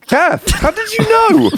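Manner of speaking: squealing high and slow, then laughing. Real, not acted. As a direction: shriek it in disbelief. Squeals at fanfic twist.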